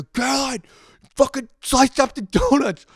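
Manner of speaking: high, loud mock yell; yelling at sister. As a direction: shriek her name in outrage. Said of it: "I yell at my sister".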